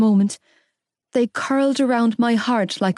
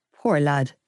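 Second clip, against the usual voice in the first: put-on father pitying the boy; gruff father; 6 semitones lower than usual. A narrator's reading. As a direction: mutter it like a sorry dad. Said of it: "my father muttered".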